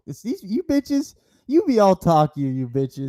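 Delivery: sassy taunt. Acted. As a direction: taunt them with attitude. Mocking rappers.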